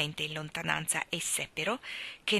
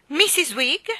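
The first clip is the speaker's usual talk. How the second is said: higher, louder indignant echo; thin outraged question; thin shocked missus Wigg?; 8 semitones higher, 9 dB louder, slower than usual. A narrator's reading. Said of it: "con una voce ancora più sottile di lei".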